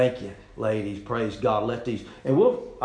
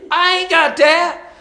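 higher and louder, slow and drawn out; mock indignant refusal; 10 dB louder, slower than usual. Preacher mimics himself balking at the dirty bin, high and loud. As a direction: mimic yourself refusing to dig in.

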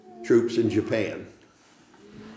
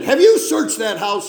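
raised, high-pitched call, retelling a soldier; retold shouted question; calling from outside; 8 semitones higher, 5 dB louder than usual. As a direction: call in to a friend inside, louder and higher. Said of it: "a voice from outside".

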